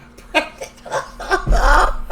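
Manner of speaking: high breathy burst of laughter; real helpless laughter; laughing. Real, not acted. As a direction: crack up after your own quote. Cracks up quoting the character.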